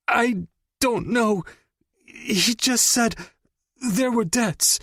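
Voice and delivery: high, slow, strained; pained pleading. Acted. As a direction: plead through the pain. Captive pleads in pain.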